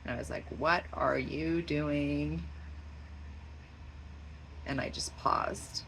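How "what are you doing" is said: slow, stretched-out question to a pet; long, lingering words with a questioning lift; drawn-out questioning. Retold or imitated. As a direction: draw every word out, rising at the end. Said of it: "talking to him in that voice".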